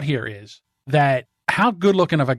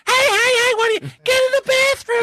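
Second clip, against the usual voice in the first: very high-pitched squawk; hammed-up falsetto scolding; mock outraged wife. Wife catching husband peeing, mock outraged.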